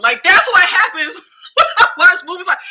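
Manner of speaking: high, loud, breaking into laughter; laughing exclamation. Real, not acted. Reviewer admitting to viewers what these movies do to her, laughing loudly. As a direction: laugh through the words, pitch jumping up.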